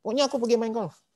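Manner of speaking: high-pitched insistent retort; suami ngotot bertengkar. Retold or imitated. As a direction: act out a stubborn husband mid-quarrel. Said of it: "Ribut, ribut, ribut". (menirukan suami ngotot dengan suara tinggi)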